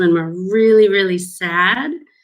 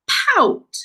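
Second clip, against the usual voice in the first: prompting; 12 semitones higher than usual. Shown as a demonstration. Teacher prompts the word to children.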